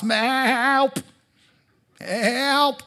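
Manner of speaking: high, mock cry for help; lost sheep calling. Preacher voicing a lost sheep calling out for help. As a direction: play a lost sheep bleating for rescue.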